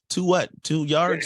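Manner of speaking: high, loud confused double take; baffled. Retold or imitated. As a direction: sound totally lost at the call. Clueless kid at first practice.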